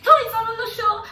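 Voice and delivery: high, loud retold shout; mock furious yell. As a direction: scream it back at the man. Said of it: "So I'm like".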